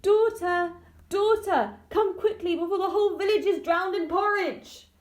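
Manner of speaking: high, loud call through the trees; mother calls desperately to her daughter in the woods. A narrator's reading. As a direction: call out frantically across the forest. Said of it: "voice calling through the trees".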